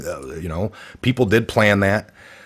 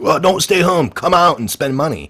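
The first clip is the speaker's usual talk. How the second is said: mocking officials; 9 semitones higher, 4 dB louder than usual. It's like.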